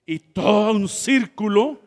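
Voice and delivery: ordinary talk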